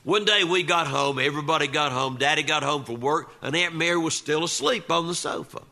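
ordinary delivery, nothing marked

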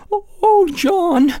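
begging. Acted. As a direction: plead with him in panic. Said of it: "she begged".

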